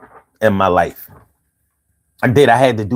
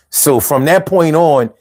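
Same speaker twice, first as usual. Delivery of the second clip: normal talking voice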